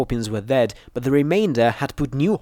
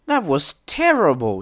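high, emphatic objection; pitched-up indignant retort; countering; 6 semitones higher than usual. A narrator's reading. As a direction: firmly contradict a friend. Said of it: "she countered".

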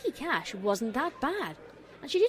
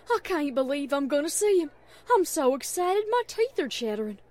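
loud, higher-pitched giddy fan; excited; 3 semitones higher, 6 dB louder than usual. A narrator's reading. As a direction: bubble over with excitement. Applejack bouncing, excited for show.

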